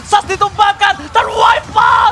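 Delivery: high, slow, shouted win call; victory call. Real, not acted. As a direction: announce the win like a finale. Caster calls the game win. (teriakan kemenangan komentator)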